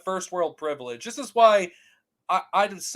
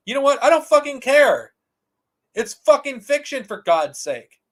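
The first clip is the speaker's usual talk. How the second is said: angry outburst; 5 semitones higher, 6 dB louder than usual. Real, not acted.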